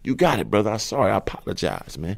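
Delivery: timid apology to the man with the knife; meek apology. Retold or imitated. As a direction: tuck your tail and apologize quietly. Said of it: "full tail tuckage".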